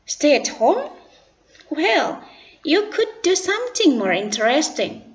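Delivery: lightly voiced schoolboy; Bayu's voice. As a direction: chime in eagerly as Bayu. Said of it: "tiba-tiba Bayu ikut berbicara".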